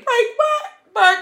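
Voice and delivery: high, hammed-up disbelief at any break; mock rich bragging. Rich friend scoffing at a relationship break, mock bragging. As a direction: play the rich girl scoffing. Flaunting an imagined rich life.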